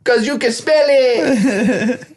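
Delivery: high, slow comic punchline; mock smart-aleck. Comedian's reply to the cop, slow high mock voice.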